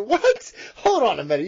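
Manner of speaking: high, loud startled "what"; incredulous. Real, not acted. Co-host breaks off on hearing the other's idea, incredulous.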